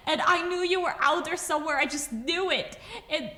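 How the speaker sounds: high, rushed, tearfully joyful outpouring; breathless rush of worry and joy, pitched high; very emotional relief. Acted. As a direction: let the words tumble out, overcome with relief. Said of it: "very emotional voice just go".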